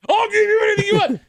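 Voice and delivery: high, loud mock devotion; hammed-up, over-eager suitor shouting; desperate admirer. Host mocking men answering the fake woman's post, desperate shout.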